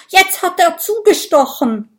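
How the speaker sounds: high, loud accusation; alarmed outcry. Acted. Dragon sure he was just stabbed, crying out. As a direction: cry out as if just stung. Dragon wrongly thinks he is pricked.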